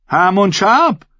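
high, drawn-out hail by name; calling out. A narrator's reading. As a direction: call to him across the hall. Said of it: "rief sie".

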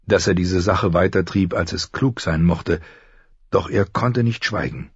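usual speaking voice

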